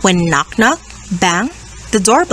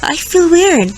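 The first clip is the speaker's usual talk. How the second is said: higher, a touch of sick quiver; sick shiver; 7 semitones higher than usual. Acted. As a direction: say it like you feel ill. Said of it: "The hippo shivered".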